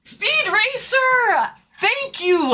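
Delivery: drawn-out high cheer; excited high call. Real, not acted. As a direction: call the name out slowly, thrilled. Thanking big gifter.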